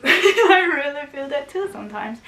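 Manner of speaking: loud, laughing through the words; real amused laugh in the voice; laughing admission. Real, not acted. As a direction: burst into a laugh while admitting it. Laughing as she admits relating.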